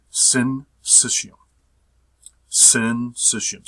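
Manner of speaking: "syncytium" sounded out slowly, sin-SISH-ee-um; careful pronunciation demo. Shown as a demonstration. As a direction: say "syncytium" slowly and firmly, as if teaching it. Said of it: "this is the way you pronounce it".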